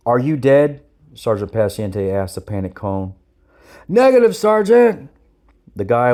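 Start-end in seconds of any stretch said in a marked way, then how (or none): 0.1-0.8 loud, raised sergeant's demand; barked question. A narrator's reading. Sergeant challenges a panicked recruit. 3.9-5.1 high, loud recruit's answer; shouted reply. A narrator's reading. Panicked recruit answers the sergeant.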